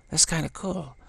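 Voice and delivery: a light hint of young admiration; impressed teen. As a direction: play a surprised young clerk. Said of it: "they're kinda like".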